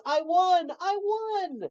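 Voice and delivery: put-on very high, childlike squeaky voice; Ralph voice. Ralph proudly announces he won, in a very high squeak. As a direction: pipe it up like a little kid.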